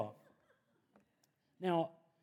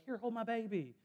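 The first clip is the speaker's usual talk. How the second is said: put-on higher, bright offer of a baby; lightly voiced eager parent, pitched up; 7 semitones higher than usual. Parents pushing their baby on him, mock eager offer. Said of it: "people were like".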